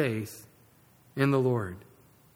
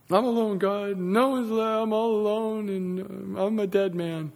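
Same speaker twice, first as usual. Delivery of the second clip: higher-pitched whimpering complaint; whiny Elijah; 5 semitones higher than usual. Acted. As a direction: whimper it to God in mock self-pity. Acts whiny Elijah answering God.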